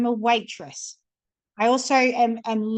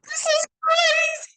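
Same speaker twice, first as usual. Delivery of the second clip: amazed; 3 dB louder, slower than usual. Retold or imitated. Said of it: "I'm like".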